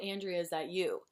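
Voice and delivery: ordinary talk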